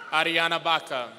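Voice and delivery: normal talking voice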